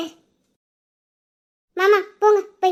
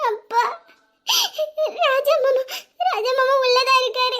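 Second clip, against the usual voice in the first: sobbing out the words through tears; crying; 4 semitones higher than usual. Acted. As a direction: break down crying to your father. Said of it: "நின்னு அழுதுகிட்டு இருக்கா". (அழுதுகொண்டே பேசுதல்)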